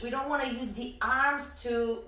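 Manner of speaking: usual speaking voice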